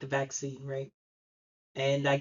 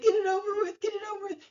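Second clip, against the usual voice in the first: high whimpering plea; imitated whimper; 13 semitones higher, much faster than usual. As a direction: whimper it like a scared patient. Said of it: "like, whimpering".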